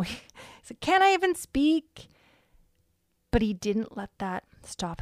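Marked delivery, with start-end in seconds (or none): 0.8-2.1 high, loud mock protest; eye-rolling exasperation. David protesting to his brother, exasperated in playful retelling. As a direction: imitate a put-upon brother, pitched up and louder. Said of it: "rolling his eyes and being like".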